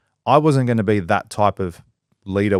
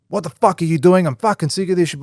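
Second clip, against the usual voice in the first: the boss going off at his worker, mock angry and higher; 7 semitones higher than usual. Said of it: "and going off at me".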